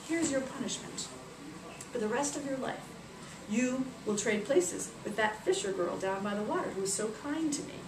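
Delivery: soft, measured, stern decree; solemn sentencing. Acted. Isis punishing the rich lady, solemn quiet decree. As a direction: pass judgment quietly with authority.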